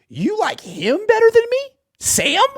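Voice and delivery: high incredulous hurt lover's whine; mock jilted lover. [name] to Kyle as a jilted partner, high hurt whine.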